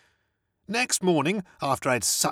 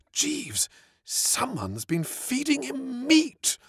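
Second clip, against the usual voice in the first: alarmed; 10 semitones higher, much slower than usual. Acted. Startled master to valet.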